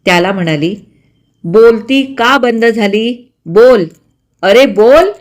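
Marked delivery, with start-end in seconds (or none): 1.4-5.1 louder, furious mother demanding an answer; mother's angry, loud scolding. A narrator's reading. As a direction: shout at him to speak. Mother beating silent son.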